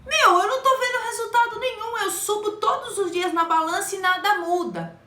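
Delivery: high-pitched exasperated patient; fed-up patient. Retold or imitated. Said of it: "ela fala assim".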